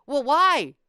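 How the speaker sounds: loud, high mock demand; imitating her outraged why; indignant mother. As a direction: snap back like the offended mother.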